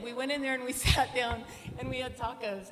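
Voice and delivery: ordinary talk, nothing marked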